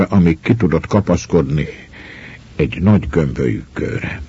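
plain reading voice

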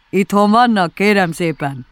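mild call into a hole. A narrator's reading. Bear calling into a burrow to ask who's home, mild shout. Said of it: "bedugta a fejét és kiáltott".

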